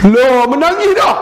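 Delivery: high, loud cry of surprise; surprised. Real, not acted. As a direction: exclaim in amazement that he's crying. Surprised man is crying. (terkejut, suara tinggi dan kuat)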